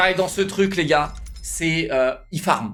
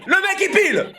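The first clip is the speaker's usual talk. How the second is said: laughing, high; 12 semitones higher than usual. Real, not acted. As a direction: repeat it much higher while laughing. Repeats laughing.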